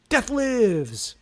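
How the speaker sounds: high, loud, drawn-out movie title; hammy title announcement. Pretend. Host teasing next week's movie title, hammy announcement. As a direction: proclaim it like a trailer's big reveal.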